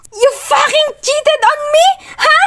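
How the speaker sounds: high, loud, crying yell; tearful scream. Acted. Heroine screaming at Achilles about cheating, tearful scream. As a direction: scream it through tears.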